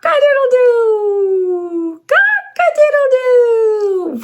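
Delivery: high, slow crowing; cock-a-doodle-doo drawn out high; rooster crow. Shown as a demonstration. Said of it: "Let's crow together like a rooster".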